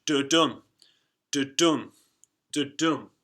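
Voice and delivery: rhythmic da-DUM, stress on dum; iambic beat da-dum. Shown as a demonstration. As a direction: tap out da-dum like footsteps. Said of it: "So I've got a dot and a dash".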